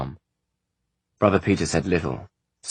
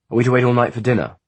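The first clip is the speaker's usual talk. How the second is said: fast, loud and higher, an impatient outburst; loud demand; 7 semitones higher, 6 dB louder, faster than usual. Acted. Luca demanding dinner from Freeze, too loud. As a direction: snap at the servant like a spoilt brat. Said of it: "he had spoken too loudly".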